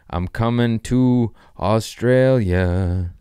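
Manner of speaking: slow, loud, drawn-out announcement; showy announcement. Shown as a demonstration. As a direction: stretch every word out big for show. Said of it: "Watch this".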